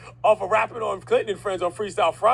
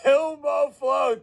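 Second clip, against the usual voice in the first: hyped shout; 7 semitones higher, much slower than usual. Acted. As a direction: call it out big like a hype man. Host echoes rapper's tag.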